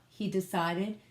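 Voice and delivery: usual speaking voice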